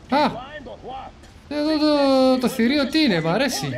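sudden thrilled exclamation; a burst of wonder at the beast; excited. Real, not acted. Excited at a creature.

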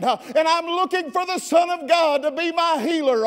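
high, full-voiced pleading shout; desperate cry. Blind man begging Jesus for healing despite hushing, desperate cry. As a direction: shout to be heard above the crowd, unrelenting. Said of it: "he got louder".

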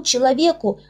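plain reading voice

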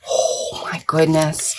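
breathless slow whisper; whispered awe. Real, not acted. Vlogger gushing over Nimona, a slow awed whisper. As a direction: hush it like a secret treasure.

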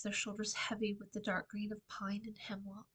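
usual speaking voice

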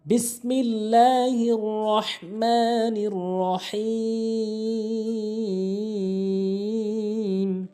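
slow, drawn-out chanted recitation; tajweed recitation, long held notes; Quran recitation. Shown as a demonstration. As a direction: recite it slowly, melodic and sustained. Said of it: "বুঝতে পারবেন যে আপনার কোন কোন জায়গায় ভুল হয়".

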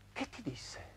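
barely voiced, very soft question; hushed question. Man asks the woman what his wife said, hushed question. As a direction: let the question out almost soundlessly.

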